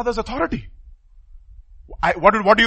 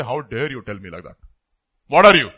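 mock offended retort; 7 semitones lower than usual.

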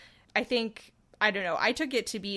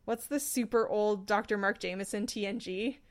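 normal talking voice

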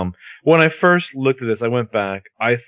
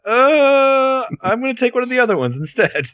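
mock reluctance; 11 semitones higher, 4 dB louder than usual. Man backing away from jalapeno bagels, mock reluctance. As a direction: imitate a wary, high-pitched polite refusal.

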